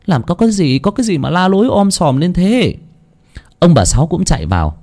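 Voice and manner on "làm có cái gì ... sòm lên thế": high, worried female voice calling in; older sister calling in, alarmed. Acted. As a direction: call out in a panic to her brother. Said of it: "vẻ mặt hốt hoảng".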